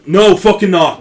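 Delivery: put-on shouted line in an Irish accent. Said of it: "no, that was Irish".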